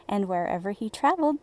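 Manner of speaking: ordinary delivery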